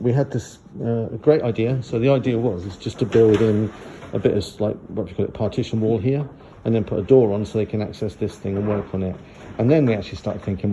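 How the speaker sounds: ordinary delivery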